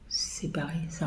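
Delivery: a quick whispered aside. Real, not acted. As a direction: breathe it out under your breath. Quiet aside. (chuchoté rapidement)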